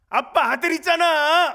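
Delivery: put-on high, loud, slow boyish declaration; supremely confident child. Little son answering his teasing father, high bold declaration. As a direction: state it as the obvious truth, slowly. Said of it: "너무너무 당당해".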